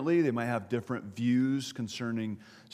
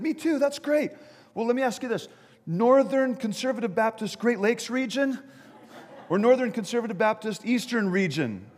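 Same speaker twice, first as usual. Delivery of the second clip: high-pitched, eager quizzing; excited calling; 8 semitones higher than usual. Acted. Bystander narrowing down jumper's region, excited calling. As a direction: call out each option with building zeal.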